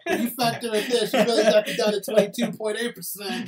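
loud, high hammed-up wrestling promo; laughing through a mock promo rant; Scott Steiner promo. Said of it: "That was the best promo".

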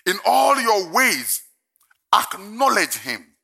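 slow, loud, solemn reading; preaching scripture slowly. Acted. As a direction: proclaim the verse deliberately.

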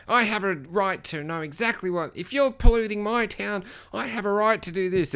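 mock a self-righteous nerd demanding his rights; nerdy male Karen. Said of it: "he's got this nerdy voice".

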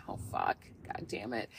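under-the-breath swearing, almost voiceless; soft, hushed annoyed oath; muttered curse. Real, not acted. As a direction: curse quietly to yourself. Swearing under breath mid-thought.

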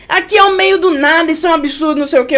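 mock outrage.

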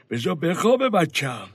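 ordinary talk

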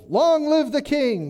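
high, loud, slow royal cheer; crowd shout. Retold or imitated. The people acclaiming their new king, shouting. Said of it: "And all the people shouted".

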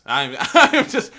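loud, slow laugh through the words; laughing loudly. Real, not acted. Podcast cohost laughing loudly in disbelief at his friend. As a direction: crack up at the news. Disgusted at Pokemon Go settings.

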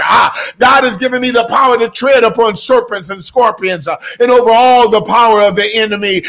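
loud, high fervent sermon declaration; preaching at full force. Acted. Sermon climax, shouted affirmations.

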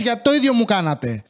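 ordinary talk, nothing marked

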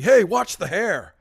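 loud slow douchebag yell. Retold or imitated. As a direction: bark it at the monkey. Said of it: "the douchebag yells".

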